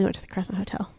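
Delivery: fast whispered confession. Real, not acted. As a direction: whisper it like a secret.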